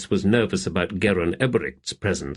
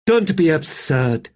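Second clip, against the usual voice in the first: high, slow sneer; 8 semitones higher, 3 dB louder than usual. A narrator's reading. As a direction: drip contempt on each word.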